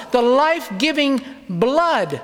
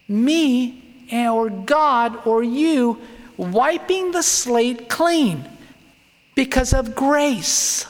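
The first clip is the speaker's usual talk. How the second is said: very slow emphasis; 4 semitones higher, much slower than usual. Real, not acted. Preacher drawing out the point.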